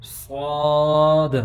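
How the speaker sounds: صاد with a heavy s and long rounded aw vowel; loud, slow, heavy صاد; full-mouthed صاد. Shown as a demonstration. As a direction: fill the mouth and hold صاد. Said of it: "صاد کو پر کر کے پڑھیں گے".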